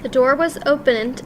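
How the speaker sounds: ordinary delivery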